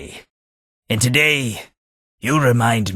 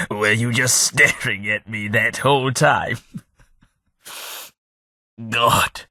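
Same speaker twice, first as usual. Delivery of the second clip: laughing; 3 semitones higher than usual. Acted. Laughs at being stared at.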